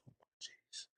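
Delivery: breathless whispered "Oh, my jeez"; whispered dismay. Retold or imitated. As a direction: whisper it in disbelief. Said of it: "I said".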